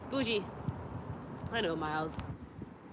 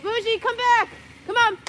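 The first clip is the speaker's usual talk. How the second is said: high, loud call to a wandering dog; bright dog-calling voice; 12 semitones higher, 4 dB louder than usual. Real, not acted. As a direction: call her back, pitched up and loud.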